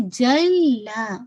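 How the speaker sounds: جلا drawn out very slowly; doubled laam demo. Shown as a demonstration. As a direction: hold the doubled l of جلا, then a long aa. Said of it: "لام پہ شد ہے تو اس کو آپ ٹوائس". (جلا میں لام پر شد)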